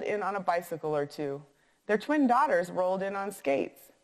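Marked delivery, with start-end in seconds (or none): none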